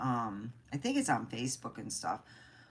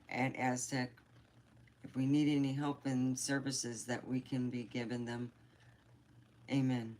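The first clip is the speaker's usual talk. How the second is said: prayer; 2 semitones lower, 3 dB quieter than usual. Real, not acted. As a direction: pray earnestly and quietly. Leads a short prayer.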